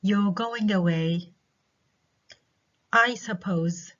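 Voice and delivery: tag added as an afterthought, slow. Shown as a demonstration. Teacher showing students an afterthought tag, slow. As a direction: trail a slow tag onto the sentence. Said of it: "as an afterthought".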